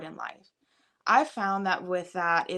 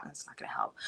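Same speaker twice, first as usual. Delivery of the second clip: whisper; 3 semitones lower, 13 dB quieter, faster than usual. Real, not acted. Aside about yelling parents.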